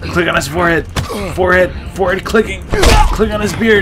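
loud high fight calls; frantic excitement. Real, not acted. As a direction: bark out the moves.

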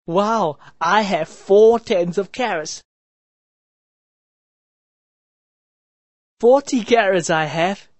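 a happy brother counting his haul. A narrator's reading. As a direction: beam with delight over the carrots. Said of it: "he said happily".

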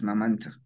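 Mamanchaj said "ma-MAN-tsaj"; Huaylas variant. Shown as a demonstration. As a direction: end Mamanchaj in tsa plus a back-of-throat fricative. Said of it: "lo pronunciamos como Mamanchaj".

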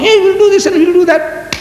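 very high-pitched boastful chatter; mock big talker.

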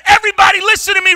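retold shout. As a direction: grab the room with a shout. Standing up on the plane.

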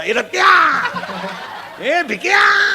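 high, hollered mock drunken calls; drunken name-calling. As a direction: holler like drunk friends calling each other. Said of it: "एकमेकाला बापाच्या नावानं हाका मारायचा". (दारुड्यांसारख्या उंच हाका)

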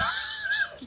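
high wailing laugh-cry. Real, not acted. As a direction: let the laugh crack into a sob, very high. Breaks into a crying laugh.

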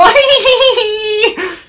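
high-pitched cackling giggle; giggling. A narrator's reading. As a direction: giggle away like naughty trucks. Trucks laugh after their song.

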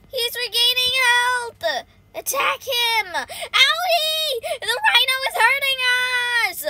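high, loud playful distress; panicked yelling. Pretend. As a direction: wail for help against an attacker. Rhino hurting the team.

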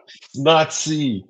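amused, emphatic slow repeat; playful drawn-out repeat. Real, not acted. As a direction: stretch it out for laughs. Laughing repeat of shared joke.

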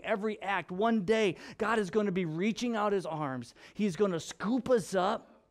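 rising, fervent preaching; impassioned pulpit build; building sermon. Acted. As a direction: swell toward the promise. Preaching builds toward God's embrace.

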